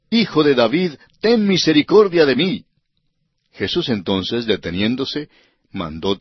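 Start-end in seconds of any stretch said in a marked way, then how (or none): 0.1-2.6 insistent crying out. A narrator's reading. Blind man persists despite the crowd's rebuke, crying out. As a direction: plead more insistently, voice lifted in pitch. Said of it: "él clamaba mucho más".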